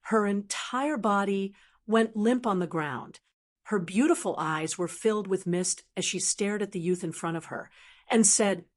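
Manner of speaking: usual speaking voice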